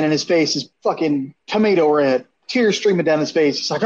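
usual speaking voice

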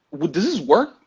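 high-pitched, baffled questioning; exasperated mock bewilderment; incredulous. Acts out his baffled reaction.

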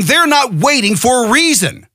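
normal talking voice

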